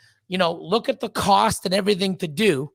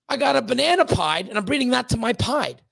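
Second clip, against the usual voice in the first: ordinary talk, nothing marked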